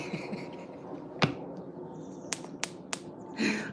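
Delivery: helpless wheezy laughter; laughing until the voice gives out; long laugh. Real, not acted. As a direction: crack up at your own accent. Cracks up after accent attempt.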